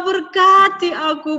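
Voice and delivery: high, loud tearful begging; loud crying plea. A narrator's reading. Esau cries loudly for his father's blessing. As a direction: wail the plea. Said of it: "Dia nangis".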